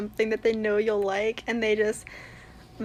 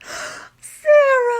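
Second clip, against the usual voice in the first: sharp gasp, then loud high cry; gasping excitement; 12 semitones higher, 10 dB louder than usual. Real, not acted. Fan spotting an underrated favourite, gasping excitement. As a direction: gasp and call out the name. Fan reacting to character match.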